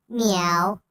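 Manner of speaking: a quick playful meow; cat meow. Pretend. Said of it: "Do I sound like a cat?".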